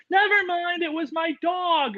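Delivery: high, slowed sheepish voice; mock sheepish viewer. Mocking a sheepish viewer correcting themselves, high and slow. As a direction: squeak it out, then drag it slow. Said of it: "they're like".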